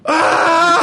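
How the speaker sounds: sudden high, loud yelp, hammed up; short, piercing mock scream; mock dumpster scream. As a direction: imitate a woman screaming as she falls into a dumpster. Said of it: "fall down into the dumpster and go".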